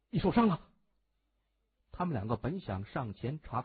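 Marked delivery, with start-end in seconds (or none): 0.1-0.6 higher, louder burst of concern; alarmed concern. A narrator's reading. Henchmen to their injured boss, alarmed. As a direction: blurt it out in alarm.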